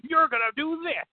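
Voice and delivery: high-pitched riled-up impression; Vince McMahon. Impersonating the riled-up boss getting a wrestler over, mock-irate. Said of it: "get his Irish up and he'd be like".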